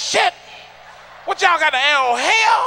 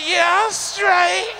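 slow; 7 semitones higher, much slower than usual. Retold or imitated. Eater answers between bites.

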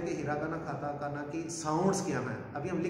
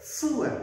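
सू pushed up high and hard; forceful high tsu; 9 semitones higher, 4 dB louder, much slower than usual. Shown as a demonstration. Teacher stresses tsu so students hear it. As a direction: punch सू with force. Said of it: "जोर से".

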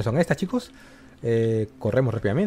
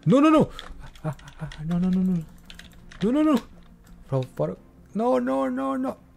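being chased; 10 semitones higher than usual. Real, not acted. Monster catches up again.

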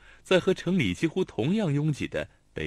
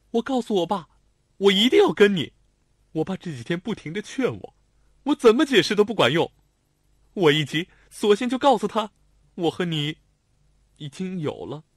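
put-on higher, louder girl's voice; insistent young woman; 9 semitones higher, 7 dB louder than usual. A narrator's reading. Lanlan tells Xiao Tong what she told her father, insistent. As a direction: blurt out the stubborn confession.

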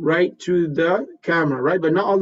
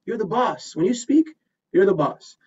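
normal talking voice

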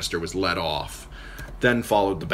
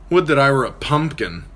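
higher, louder mock lament; playful self-mockery, a touch theatrical; Tyrion's wry voice; 7 semitones higher, 6 dB louder than usual. Tyrion joking to Catelyn's uncle, mock lament. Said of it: "The dwarf gave a bark of laughter".

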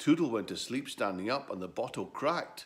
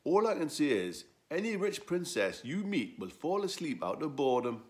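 donkey voice; 7 semitones higher, 6 dB louder than usual. Acted. Donkey mocks Edwo.

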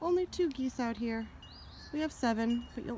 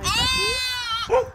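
higher, louder, slow sing-song; slow pet talk; 14 semitones higher, 9 dB louder than usual. Real, not acted. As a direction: croon it out to the ducks. Showing the ducks.

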